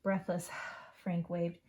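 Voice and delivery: usual speaking voice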